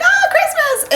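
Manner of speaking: very high squealed exclamation; hammed-up shriek with a laugh in it; mock frantic cry. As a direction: play an over-the-top Christmas freak-out. Acting out the build-up to Christmas.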